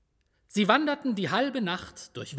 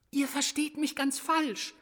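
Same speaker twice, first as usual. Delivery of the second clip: higher, gentle little angel; soothing angel; 8 semitones higher than usual. A narrator's reading. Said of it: "versuchte Daniel, ... zu beschwichtigen".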